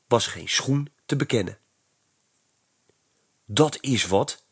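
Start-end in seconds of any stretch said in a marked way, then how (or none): none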